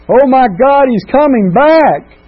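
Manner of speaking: high, loud alarmed yell, the last words drawn out; panicked scream. Retold or imitated. Grandfather yelling to grandmother as the drunk grandson arrives, panicked scream. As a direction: shriek a warning to the wife. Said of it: "scream to my grandmother".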